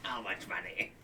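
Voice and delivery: hushed, voiceless aside; whispered question. Pretend. Furtive question about the bag.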